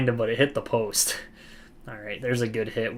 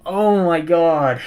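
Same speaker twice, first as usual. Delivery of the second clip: exasperated groan; 4 semitones higher, 9 dB louder, much slower than usual. Real, not acted. Aftermath of missed chance.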